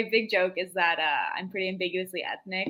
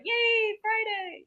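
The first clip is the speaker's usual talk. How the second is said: high, chirpy office cheer; fake cheery; much slower than usual. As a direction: chirp it like a perky coworker. Fake office cheer, much higher.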